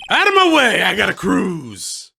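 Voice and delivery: hammed-up gangster shakedown; angry gangster. Gangster leaves in a hurry.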